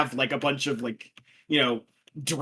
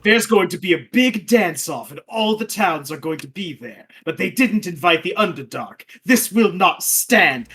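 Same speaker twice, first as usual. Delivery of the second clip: hammy villain speech; 4 semitones higher, 6 dB louder than usual. Acted. Drow villains plotting dance revenge.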